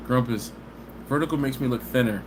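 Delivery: usual speaking voice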